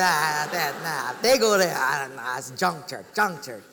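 mock a scoffer waving it all away; dismissive skeptic. Skeptic naming church members to the pastor, scornful. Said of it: "he was giving me a hard time".

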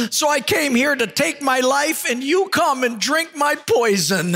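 high, wailing comic despair; hammed-up sobbing, gasping between phrases; mock crying. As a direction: blubber like a joke's heartbroken loser. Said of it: "that city slicker starts crying".

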